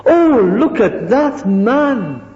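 high, slow pitying exclamation; mock pity. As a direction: coo over the poor man like a sentimental onlooker. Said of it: "so people might say".

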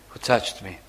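Jesus quietly asking the crowd again; soft question. Acted. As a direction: ask it again, hushed and low. Jesus repeats his question.

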